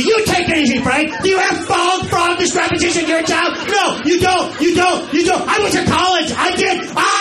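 very high shouted rant; shrieking wife. Retold or imitated. Attendant screaming at her husband Frank, shrill rant.

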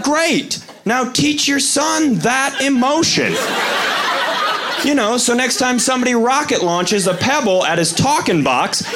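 milk every word with high sarcasm; sarcastic retort. Retold or imitated. Sarcastic retort to angry friend.

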